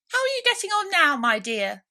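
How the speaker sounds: very high, loud solicitous mouse; an over-kind little host; mouse to Alice. A narrator's reading.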